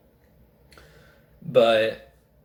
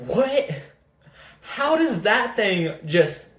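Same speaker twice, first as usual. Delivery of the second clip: high-pitched incredulous what; retold disbelief; 8 semitones higher, slower than usual. Buyer recounting the fallen drive shaft, baffled. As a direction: shoot the pitch way up in astonishment.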